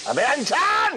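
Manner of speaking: irate boss calling out a name; angry summons. Acted. Boss summoning his employee, angry summons. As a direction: call him out sharply, stretching the name. Boss angrily calls out servant.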